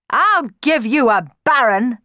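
loud, drawn-out angry threat; heated retort, stretched for menace; snapping. A narrator's reading. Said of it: "snapped Dandini".

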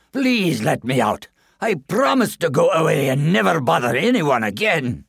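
the trapped goblin begging the lass to free him; pleading goblin. Acted. Goblin begs from the mill.

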